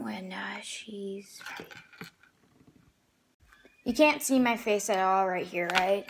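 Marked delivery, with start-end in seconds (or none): 0.0-1.7 hushed whisper. Real, not acted. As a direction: whisper it under your breath. Whispering before blindfold challenge.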